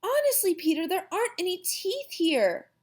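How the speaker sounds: put-on high little-girl whine; protesting girl. A narrator's reading. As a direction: insist in a high, upset voice. Upset Sheila protests to Peter.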